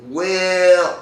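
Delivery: hammed-up hemming and hawing, loud and high; unconfident stalling. Shown as a demonstration. Actor handed a cold read, mock unsure stalling. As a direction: stretch out each filler in mock hesitation. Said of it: "Instead of".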